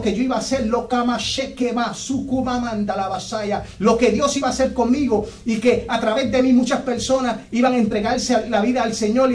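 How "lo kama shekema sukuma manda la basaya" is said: speaking in tongues. Real, not acted. Preacher praying in tongues to the church, slow and rhythmic. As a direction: pour out ecstatic syllables slowly.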